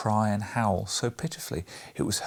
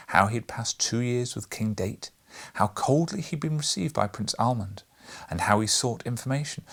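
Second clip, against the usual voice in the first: usual speaking voice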